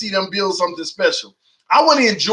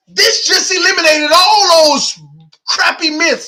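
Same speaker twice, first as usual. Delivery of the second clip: fired-up rant; 6 semitones higher, 3 dB louder than usual. Real, not acted. Fan ranting about tanking claims.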